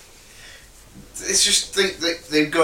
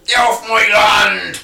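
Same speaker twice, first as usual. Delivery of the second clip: high, loud, slow yell; angry pig voice; 7 semitones higher, 8 dB louder, slower than usual. Pretend. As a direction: bellow it like a territorial pig. Voicing territorial sewer pig.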